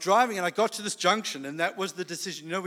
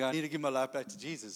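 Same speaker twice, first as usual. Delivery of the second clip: ordinary talk, nothing marked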